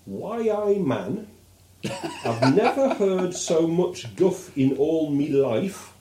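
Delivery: a hint of a Geordie, slow; lightly mock the union man's Geordie; Geordie trade unionist. Host quotes the trade union friend in a light Geordie voice. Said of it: "his chum said".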